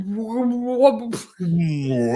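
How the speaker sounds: lightly voiced garbled wah-wah; words fading away into cartoon wah-wah, a light imitation; cartoon teacher wah. A hint of the muffled cartoon teacher. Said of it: "the world fades away".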